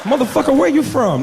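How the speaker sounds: loud, high swooning outburst; mock female excitement; delighted woman. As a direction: gush loudly, high-pitched. Woman swooning over the accent.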